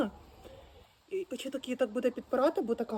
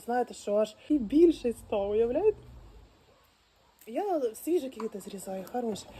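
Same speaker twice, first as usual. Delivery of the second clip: ordinary talk, nothing marked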